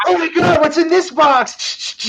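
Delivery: put-on squealing like a kid at Christmas, high; excited kid. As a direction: gasp at a present. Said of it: "You're like".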